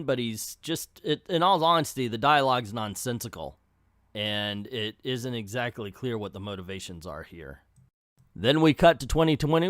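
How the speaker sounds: ordinary delivery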